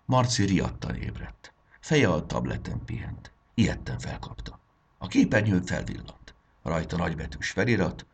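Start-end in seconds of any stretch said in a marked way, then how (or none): none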